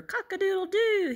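high, loud crowing; rooster crow. A narrator's reading. Reader imitates a rooster for children, loud crowing. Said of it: "He can sound like a rooster".

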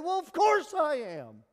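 loud, high, breezy "of course"; jaunty high imitation of a brash answer; mock cocky reply. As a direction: toss it off like it's obvious. Preacher voicing a cocky Jesus.

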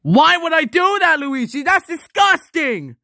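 high, loud cry of revulsion; disgusted outburst. Acted. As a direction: recoil in outrage at a revolting request. Appalled by request to rub toes.

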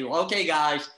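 mock virus commander ordering troops, loud and high. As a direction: bark it like a virus general rallying germs. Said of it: "the virus looks at you ... and say".

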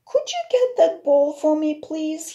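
put-on high, loud little boy asking; small boy voice. A narrator's reading. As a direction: ask sweetly like a small boy. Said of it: "he asked George".

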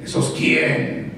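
low, slow growling Grinch. Retold or imitated. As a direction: sneer it like the Grinch. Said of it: "El Grinch".

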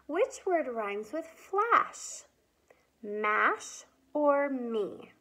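slow, drawn-out question; slow teacher question. Shown as a demonstration. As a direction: stretch each word for children. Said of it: "Time for rhyming".

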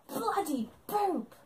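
high, slow mock-British yelp; imitated British accent. Retelling his yelp of pain on stage, mock British accent. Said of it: "Please forgive my British accent".

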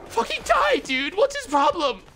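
high loud frustrated scream; furious yell. Real, not acted. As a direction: rage at a tanky enemy. Enemy won't die.

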